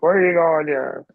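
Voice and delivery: mock heckle in a put-on voice; imitated teammate heckling. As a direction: imitate a buddy hollering after someone leaving. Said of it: "Theo would be like".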